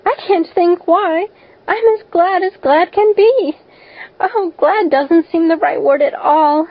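put-on high-pitched, overjoyed and puzzled girl; lifted, emotional child's voice; bewildered. A narrator's reading. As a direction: gush with joy, baffled by it. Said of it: "said Anne in a tone of bewilderment".

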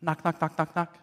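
tiny, soft imitated knocks; hushed rapid knock-knock; little knocking. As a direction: tap the words out very softly, evenly. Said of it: "Then he hears a little".

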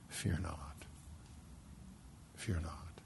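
soft reassuring whisper; hushed whisper. Acted. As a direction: whisper it gently, like God at a deathbed. God reassuring at last breath.

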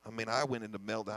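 normal talking voice

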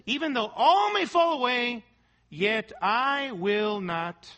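slow, weighty stress on "I will not"; emphatic declaration. Acted. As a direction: quote the vow with loud "all may fall away", then a spaced-out "I will not". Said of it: "The structure there in the Greek is emphatic".

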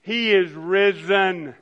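slow, loud call and response; slow building shout of He is risen. Shown as a demonstration. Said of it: "increasing volume just to remind ourselves".

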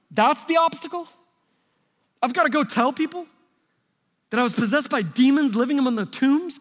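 high-pitched, disbelieving questions, each rising; acted incredulous protest. Healed man questioning God about the obstacle, acted incredulity. Voices demoniac protesting Jesus's odd command.

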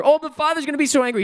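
high, fast mock wail; panicked boy. Boy answering a lunch invitation, mock panic. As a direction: rush it out as a fretting child.

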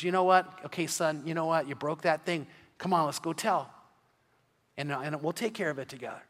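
soft, calm, reassuring father; gentle dad. Retold or imitated. Good dad calming his son after a breakage, softly and reassuringly. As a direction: speak softly to a clumsy son. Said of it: "the dad was so good. He goes".

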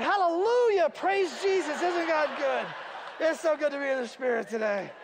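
high, loud hallelujahs, laughing; sudden gushing joy. Retold or imitated. Pastor suddenly echoing Sue after hearing of money, high and gushing. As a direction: mimic her ecstatic praise. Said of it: "And I said".

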